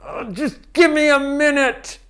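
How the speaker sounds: put-on high, loud, exasperated child voice; a cross six-year-old huffing it out; sulky kid. Boy in the back seat, mad at his father, whining high and loud. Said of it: "he's so mad".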